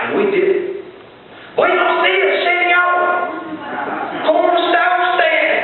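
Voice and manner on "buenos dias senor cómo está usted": yelling Spanish. Retold or imitated. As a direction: shout it out like a drilled language class. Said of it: "we would yell and yell".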